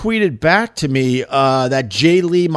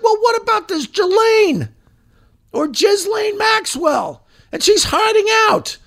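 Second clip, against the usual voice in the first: higher, pressing mock voice; mock indignant victims. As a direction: ham up their demand for answers. Imitating victims asking about Ghislaine.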